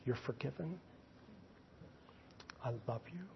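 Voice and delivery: very soft, hushed and tender; tender forgiveness. Acted. God answering the sinner who hated him, tender forgiveness. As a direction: drop low and gentle to absolve. Voices God's response of forgiveness.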